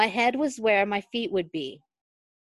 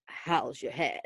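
softer, lower sleepy rumble; 6 semitones lower, 7 dB quieter than usual. Acted. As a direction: murmur it low and drowsy. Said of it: "he rumbled".

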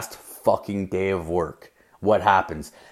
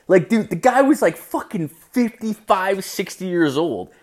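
higher pitched disbelief; incredulous; 8 semitones higher than usual. Real, not acted. Comic telling the crowd about the old guy, high incredulous. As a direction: sell how shocked you are at his age. Amazed at old guy's age.

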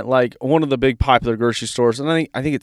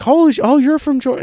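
mock astonished cashier; 12 semitones higher than usual. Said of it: "she's like".

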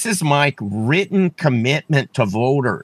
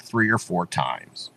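ordinary talk, nothing marked

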